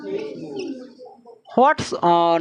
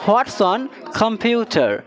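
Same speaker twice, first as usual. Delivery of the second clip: What's on computer? said slowly word by word; slow, clear teacher model; slow model reading; much slower than usual. Shown as a demonstration. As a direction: spell it out slowly for learners. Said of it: "কি বলবো?".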